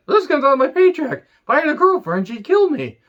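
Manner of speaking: high, whiny put-on loser voice; mock whiny fan. Podcaster mocking a biker fan, high whiny voice. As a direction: whine it like a broke, bitter fan. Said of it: "they were like".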